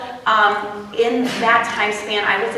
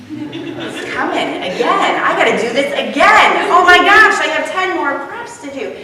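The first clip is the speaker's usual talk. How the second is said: rising panic; 6 semitones higher, 6 dB louder than usual. Retold or imitated. Teacher panicking over ten more preps, rising to a loud high wail. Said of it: "it would be like".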